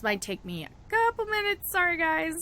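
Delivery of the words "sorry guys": ordinary delivery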